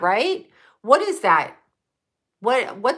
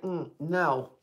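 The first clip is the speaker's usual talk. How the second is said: dismissive no; 5 semitones lower, 9 dB quieter than usual. Retold or imitated. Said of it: "I'd be like".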